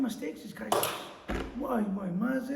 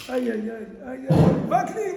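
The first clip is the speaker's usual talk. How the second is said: ordinary talk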